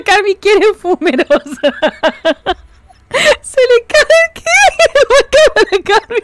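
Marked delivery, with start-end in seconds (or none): 3.4-6.2 laughing. Real, not acted. Cracking up at chat joke.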